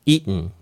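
eaten with the T swallowed into a glottal stop. Shown as a demonstration. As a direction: say "eaten" as "ee-n", no T release. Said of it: "Tを飲み込むので". (Tを飲み込んだ「eaten」)

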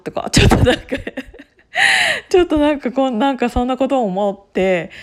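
loud, high, giggly stumble; words broken up by real giggles; laughing while talking. Real, not acted. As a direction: crack up halfway through the thought. Cracks up at her own musing.

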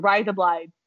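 "razor blades" as "rise-a blides", Aussie vowels; broad put-on Australian accent on "razor blades". Shown as a demonstration. Host demonstrating the trick to a friend, Australian accent on "razor blades". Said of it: "but in an Australian accent".

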